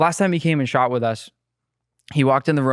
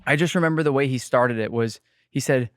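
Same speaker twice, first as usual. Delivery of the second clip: ordinary delivery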